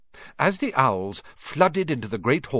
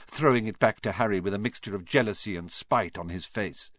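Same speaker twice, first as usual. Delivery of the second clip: usual speaking voice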